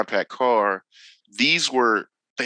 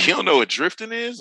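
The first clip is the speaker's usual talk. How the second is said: higher, imitated disbelieving question; surprised question; 7 semitones higher, faster than usual. Driving instructor quizzing the newcomer about drifting, surprised. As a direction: act out a puzzled instructor checking.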